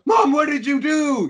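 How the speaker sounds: high, loud, horrified mock cry; shocked. Son discovering the guns are gone, shocked. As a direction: cry out to Mom in acted shock. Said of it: "this shocking moment of".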